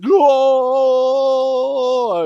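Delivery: high, loud whoop out the bus window; mock cheering shout; wild yell. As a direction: let out a big excited cry. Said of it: "I was like".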